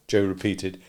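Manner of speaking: ordinary delivery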